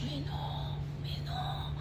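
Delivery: hushed, near-whispered disbelief; retold stunned disbelief. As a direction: let it out softly, as if unable to believe it. Incrédulité rejouée devant les vidéos. (incrédulité soufflée)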